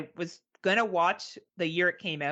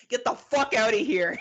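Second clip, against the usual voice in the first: mock angry dismissal; 6 semitones higher, 5 dB louder than usual. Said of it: "and you're like".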